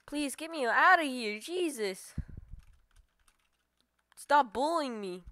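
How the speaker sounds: half-joking whine to stop the bullying; mock bullied victim. Streamer pretending enemies bully him, light mock whine.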